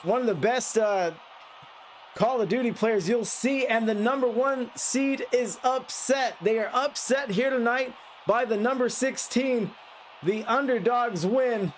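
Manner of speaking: upbeat caster call of the upset; wrap-up play-by-play. Acted. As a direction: announce the underdog win.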